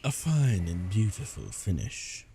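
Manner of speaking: slow, plummy posh English. Real, not acted. Gamer admiring a kill, posh and slow. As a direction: savour it like a posh gentleman. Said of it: "I don't know why I sounded so posh there".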